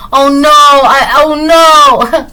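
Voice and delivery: loud, high, drawn-out wail of dismay; mock panic. Acting out panic the listener avoids.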